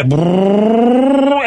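long, high rolling brrr rising in pitch; imitated engine noise. Mimicking a guy racing through traffic, rising engine brrr. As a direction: rev like a speeding car.

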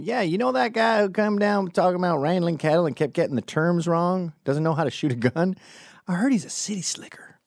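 high put-on ranch-hand voice; gossiping cowboy. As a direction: imitate a cowboy griping about an impostor. Imagined cowboys gossiping about impostor.

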